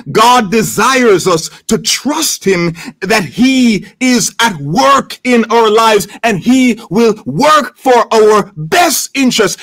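normal talking voice

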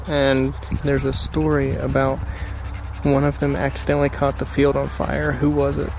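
usual speaking voice

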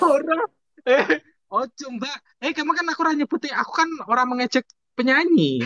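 high, fast flustered protest; pitched protest. Real, not acted. Protesting to the co-host he never mocked the singer, flustered and high.

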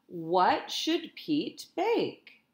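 ordinary delivery, nothing marked

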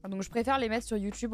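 ordinary talk, nothing marked

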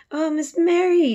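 alarmed maid crying to Mary; cried out in fright. Acted. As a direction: cry out high, scared for her job. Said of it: "she cried".

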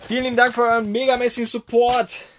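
high, loud shout-out; shouted thanks. Real, not acted. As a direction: belt out thanks to the supporters.

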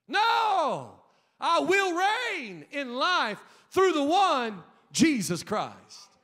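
high, loud, fired-up proclamation; preaching shout. Real, not acted. Pastor firing up the congregation, shouting with joy. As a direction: bellow it like a victory cry.

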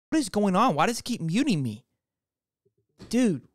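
annoyed cry at a glitchy stream; real frustration. Real, not acted. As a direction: blurt it out, fed up with the muting tech. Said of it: "This is extremely frustrating".

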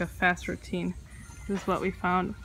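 usual speaking voice

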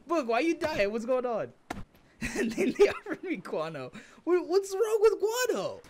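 laughing. Real, not acted. Friend laughing at joke.

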